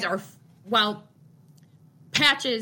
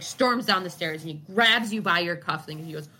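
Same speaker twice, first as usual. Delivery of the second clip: ordinary talk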